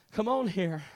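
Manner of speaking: ordinary talk, nothing marked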